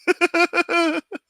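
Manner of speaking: high cackling hyena giggle. Real, not acted. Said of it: "doing the hyena giggle".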